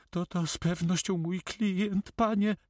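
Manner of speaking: high-pitched, sighing notary voice. Acted. Notary answers the pirate about his client, high and sighing. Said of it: "wzdychając".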